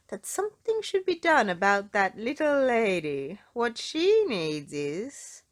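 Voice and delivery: lightly voiced, slowing to a thoughtful pause; musing doctor. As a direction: mull it over, trailing off. Doctor musing about a plan.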